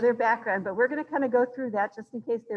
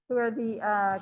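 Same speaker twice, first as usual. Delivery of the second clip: ordinary talk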